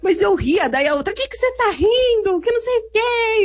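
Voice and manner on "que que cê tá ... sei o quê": high-pitched mock scolding; indignant challenge. Angry rival confronting her for laughing, mock indignant. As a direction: imitate someone picking a fight. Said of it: "começou a brigar comigo".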